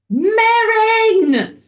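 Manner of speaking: long, high, loud demonic yell; screamed name. Retold or imitated. Possessed girl screams for the priest outside, drawn-out shout. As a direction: stretch it out in a full-voiced high shout. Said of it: "And she screams".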